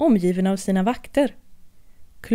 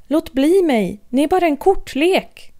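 shouting; 6 semitones higher, 3 dB louder than usual. Acted. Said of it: "skrek hon".

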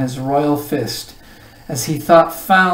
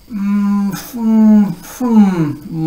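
loud, high wordless hum through food; mouth-full mumble; 7 semitones higher, 5 dB louder, much slower than usual. A narrator's reading. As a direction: mumble helplessly with a stuffed mouth. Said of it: "mumbled the earl".

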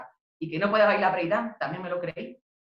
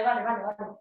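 ordinary talk

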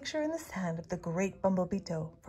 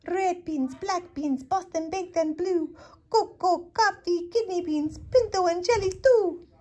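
much higher squeaky little-dog voice; tiny chihuahua voice; 10 semitones higher, 6 dB louder than usual. A narrator's reading. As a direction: rattle off the list as the smallest dog. Tiny chihuahua lists beans.

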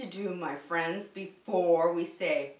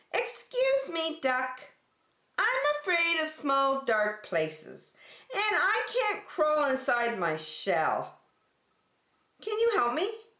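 much higher, pleading small-turtle voice; timid little turtle; 7 semitones higher than usual. Acted. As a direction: ask for help like a shy scared turtle. Franklin asks for help.

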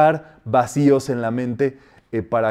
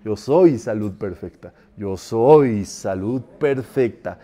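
"Yo soy salud perfecta" low, "soy" stretched out, slower on the repeat; gripping intonation; 5 semitones lower, slower than usual. Shown as a demonstration. Teacher showing varied feeling, low drawn-out "soy". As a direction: dwell on "soy" until it takes hold. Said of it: "El asunto es que te agarre".

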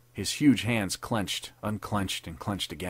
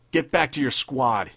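loud roared order; roaring; 5 semitones higher, 6 dB louder than usual. A narrator's reading. Captain roaring at the private to get back. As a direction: bark him back to his squad. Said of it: "he roared".

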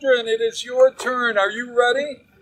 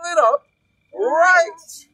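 calling out; 6 semitones higher, 5 dB louder, slower than usual. Shown as a demonstration. Leading a group chant.

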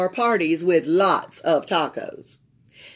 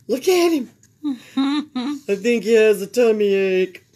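laughing; 2 semitones higher, 5 dB louder than usual. A narrator's reading.

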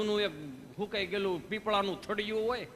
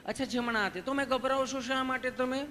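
higher, sweet and caring; loving nurse; 5 semitones higher than usual. Retold or imitated. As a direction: gently reassure a nervous patient. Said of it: "તમે કેટલું પ્રેમથી પૂછો છો".

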